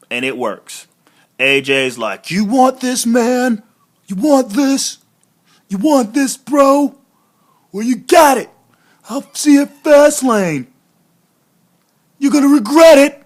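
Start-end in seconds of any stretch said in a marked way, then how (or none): 2.3-13.2 high, loud taunting heel voice; trash-talking rival before the big match, loud and high; mock taunting wrestler. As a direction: taunt him like a cocky heel, building. AJ Styles taunting Jericho, acted.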